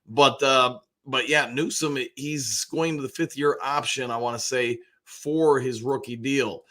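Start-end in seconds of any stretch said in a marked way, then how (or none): none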